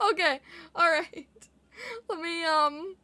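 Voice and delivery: ordinary delivery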